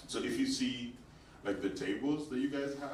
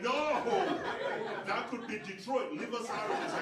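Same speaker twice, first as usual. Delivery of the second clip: mock outrage; 11 semitones higher, 4 dB louder than usual. Retelling own outraged reply.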